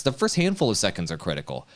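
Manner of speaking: ordinary delivery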